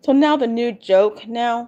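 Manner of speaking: usual speaking voice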